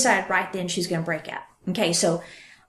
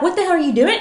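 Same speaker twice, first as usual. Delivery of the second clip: higher, louder mock confrontation; pretend yelling; 8 semitones higher, 5 dB louder, much faster than usual. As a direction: snap it at the creepy ex as if it were real.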